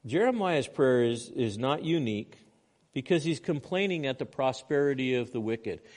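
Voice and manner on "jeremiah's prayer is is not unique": ordinary talk, nothing marked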